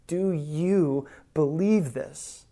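drawn out at half speed; deliberate, weighty asking; slow, pointed question. Acted. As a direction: put the question slowly, word by word. Voices Jesus' question to Martha.

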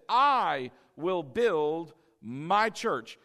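slow "I will build my church", word by word; emphatic quote. Retold or imitated. Repeats key quote for emphasis.

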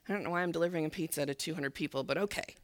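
low, quick, muttered grudging thought; reluctant bafflement. Retold or imitated. Pizza man facing a church of two hundred, baffled mutter. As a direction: mutter it under your breath, baffled but going along. Delivery man's baffled look, voiced.

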